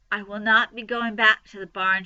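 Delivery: usual speaking voice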